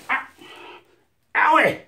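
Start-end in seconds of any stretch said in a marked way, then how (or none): 1.4-1.8 pained cry. Acted. Reacts to pain.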